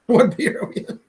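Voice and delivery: laughing high through the question; amused high repeat. Real, not acted. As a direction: giggle the question out.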